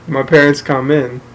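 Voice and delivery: ordinary delivery, nothing marked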